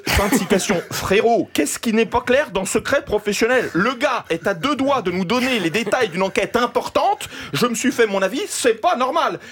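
normal talking voice

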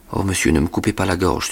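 ordinary delivery, nothing marked

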